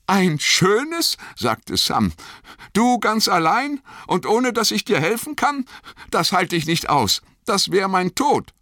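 high, upset hobbit voice; wounded, pleading not to be left; distressed pleading. A narrator's reading. Sam pleads with Frodo not to leave him, distressed.

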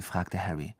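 ordinary delivery

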